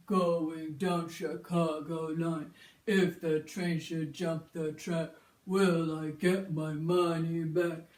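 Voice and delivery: put-on slow, low old grandpa chant. Shown as a demonstration. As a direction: creak along like an old man with a backache. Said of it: "in your grandpa voice".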